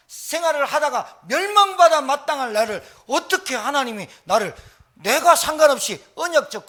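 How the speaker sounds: loud, high impassioned preaching; fervent high shouted sermon climax; full-force preaching. Acted. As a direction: pour it out like a revival preacher.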